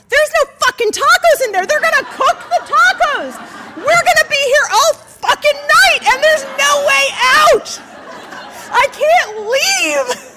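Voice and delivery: high, full-volume exasperated shouting; despairing rant. Retold or imitated. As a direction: wail it like someone trapped all night. Despairing rant at slow tacos.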